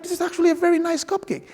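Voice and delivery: high-pitched, quick retold praise; pleasantly surprised compliment. Passenger compliments his seatmate's baking, high and impressed. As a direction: voice lifts high, quickly admiring.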